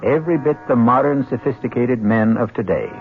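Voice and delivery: measured scene-setting narration over music; old-time radio narrator opening an act; radio drama narration. A narrator's reading. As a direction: paint the scene for listeners, with a pointed lift on witchcraft.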